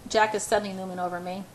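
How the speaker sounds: ordinary delivery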